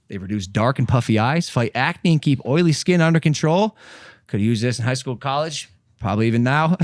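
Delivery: lightly announcer-style ad read. Acted. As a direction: read the sponsor spot with pep. Reads sponsor ad.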